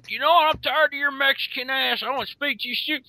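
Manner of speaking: mock angry caller. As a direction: rant like an irate caller on the phone. Angry caller voiced in a story.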